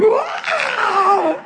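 high mock crying wail; hammed-up high cry. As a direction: fake a high sob of a wail. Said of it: "You're like".

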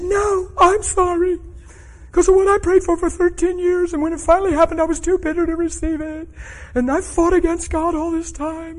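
very high, loud mock crying; hammed-up bawled confession; imitated tearful wail. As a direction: imitate someone confessing through sobs, pitch held way up. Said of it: "It was just cry, tears, slobber".

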